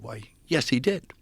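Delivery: high, loud mock-prim answer; prim mock reply. Answering the old priest, high loud prim reply. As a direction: answer like a polite schoolchild.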